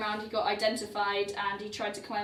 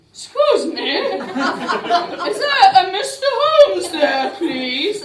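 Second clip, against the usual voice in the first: hammed-up fake British accent, louder and higher with a laugh; bad British accent; 8 semitones higher, 15 dB louder, much slower than usual. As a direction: put on a terrible posh British accent, giggling. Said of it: "Probably had a really bad British accent".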